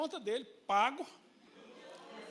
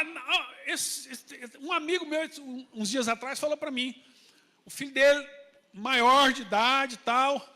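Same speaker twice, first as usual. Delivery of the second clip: ordinary talk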